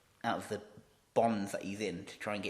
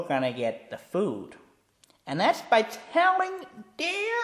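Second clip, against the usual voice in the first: high, loud, slow taunting villain; Joker voice; 14 semitones higher, 12 dB louder, much slower than usual. Acted. Joker pressing Robin about the food, taunting. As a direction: lean in and purr the threat.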